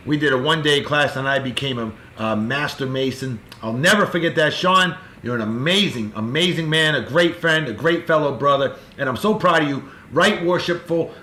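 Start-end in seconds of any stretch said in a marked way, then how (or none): none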